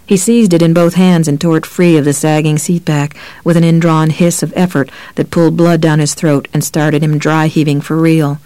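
usual speaking voice

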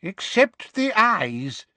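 put-on slow, wheezy old wizard. Old wizard at the back, wheezy and slow. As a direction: breathe it out, wheezing. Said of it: "said a wheezy-voiced".

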